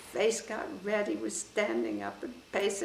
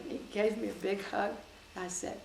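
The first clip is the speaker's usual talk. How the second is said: ordinary talk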